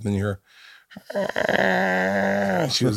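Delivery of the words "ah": put-on a short high wail like a baby; imitated baby cry. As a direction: cry out once like an infant. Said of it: "Is that a baby crying?".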